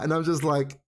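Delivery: usual speaking voice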